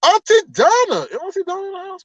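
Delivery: very high sing-out welcome; excited greeting. Real, not acted. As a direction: hail a favorite guest in a squealed high voice. Hails a viewer joining.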